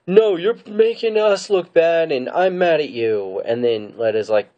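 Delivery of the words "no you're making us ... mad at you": high, drawn-out mock scolding; really mad. Ahsoka confronting the prisoner, acting really mad. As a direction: scold slowly in a put-on angry voice. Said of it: "she's really mad".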